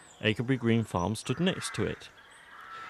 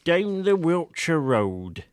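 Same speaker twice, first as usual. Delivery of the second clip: put-on slow, higher, rustic old voice reading a title; old man reading aloud; 7 semitones higher, 4 dB louder, much slower than usual. A narrator's reading. Old Collingbourne reads the article title, slowly in his rustic voice. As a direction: spell out the headline like an old countryman. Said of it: "the old man read out".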